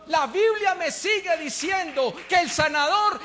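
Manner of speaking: thundering sermon climax; full-force preaching. Acted. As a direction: proclaim it to the back of the church.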